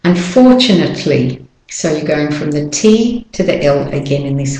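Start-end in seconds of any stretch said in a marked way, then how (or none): 0.1-1.4 British-Australian "Unfortunately", R left silent; British and Australian accent. Shown as a demonstration. Teacher contrasts accents for learners, non-rhotic British-Australian "Unfortunately". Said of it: "but in the British and Australian, no".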